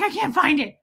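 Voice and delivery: mock out of breath. Streamer acting out a fruitless scroll for a comment, winded. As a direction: blurt it as if worn out from running. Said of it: "it makes me out of breath".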